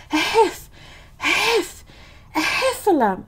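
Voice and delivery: high, puffing, broken attempts at the word; out of breath. A narrator's reading. Piglet telling Christopher Robin of the Heffalump, out of breath. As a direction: stammer it out, winded and panicky. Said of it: "breathing so hard that he could hardly speak".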